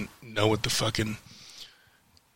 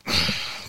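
frustrated sigh; 4 dB quieter than usual. Real, not acted.

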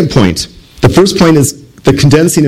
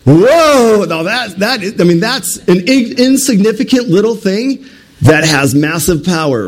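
higher, astonished exclamation; amazed whoa; 5 semitones higher than usual. Retold or imitated. Bowlers stunned by the BB, amazed. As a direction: gasp in wonder at the little thing. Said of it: "Then you guys would be like".

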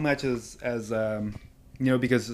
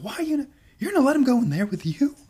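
much higher, fast, squeaky; high squeaky mock voice; 12 semitones higher, faster than usual. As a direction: pitch it way up and rush it. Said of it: "He's like".

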